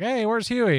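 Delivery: high-pitched, imitated puzzled query; puzzled calling out. Bandmate looking for the vanished star, mock puzzled. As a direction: pitch it up as if looking round for someone.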